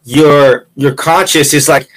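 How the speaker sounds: ordinary talk, nothing marked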